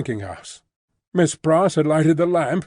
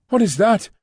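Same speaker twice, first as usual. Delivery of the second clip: startled cry; 7 semitones higher, 3 dB louder than usual. A narrator's reading. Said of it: "she cried all at once".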